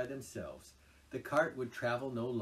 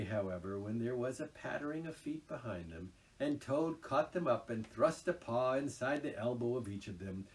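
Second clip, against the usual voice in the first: plain reading voice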